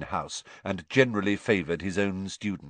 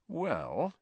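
soft, high, confiding tone; lowered voice; 9 semitones higher, 6 dB quieter than usual. A narrator's reading. Malfoy confiding his scheme to Harry, hushed. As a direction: begin softly, as a secret. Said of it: "lowering his voice to a whisper".

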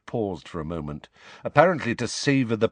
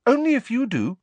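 high and eager; 5 dB louder than usual. Acted. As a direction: blurt it out hopefully. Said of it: "she said eagerly".

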